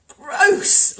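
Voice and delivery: high, loud cry of disgust. Real, not acted. Real disgust at the world's leaders, high and loud. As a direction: spit it out in revulsion. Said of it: "I'm just so grossed out".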